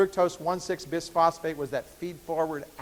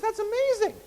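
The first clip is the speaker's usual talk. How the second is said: squeaky high lady voice. Retold or imitated. Lady amazed by the bird, in a high voice. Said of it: "I got to use the high voice there".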